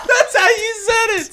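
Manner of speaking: high, loud words through laughter; cracking up, barely able to speak; laughing hard. Real, not acted. Friend insists while breaking up with laughter.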